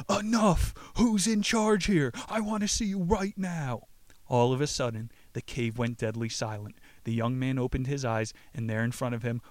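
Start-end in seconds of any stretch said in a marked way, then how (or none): 0.1-3.9 high, forceful demanding shout; desperate yell. A narrator's reading. Young man in the cave yells to silence the voices, high demanding shout. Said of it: "and yelled".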